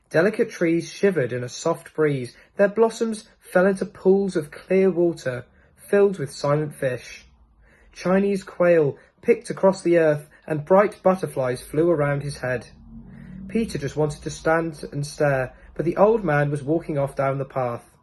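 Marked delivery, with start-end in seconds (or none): none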